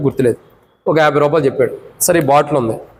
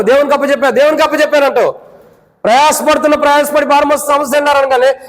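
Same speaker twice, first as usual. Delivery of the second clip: fervent preaching; 7 semitones higher than usual. Acted. Pastor warning the congregation about vain words, in fervent high preaching. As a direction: preach it hard, rising and driving.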